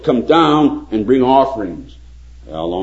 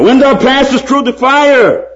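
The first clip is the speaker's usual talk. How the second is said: high, loud preaching of scripture; fervent pulpit reading; 6 dB louder, slower than usual. Acted. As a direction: proclaim the verse with full force, drawn out. Quoting Isaiah at full force.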